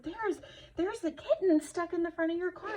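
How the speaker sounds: urgent retelling. Retold or imitated. Urgent explanation to driver.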